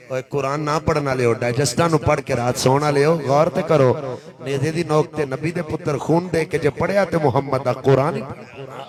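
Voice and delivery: loud preacher's rebuke. Acted. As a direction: thunder it at the careless. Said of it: "Hussain ये जरूर आख्या है".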